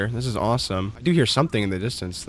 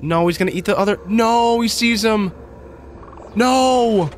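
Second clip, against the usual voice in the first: terrified dismay; 9 semitones higher, slower than usual. Real, not acted. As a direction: cry out helplessly as the monster attacks. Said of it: "I'm terrified".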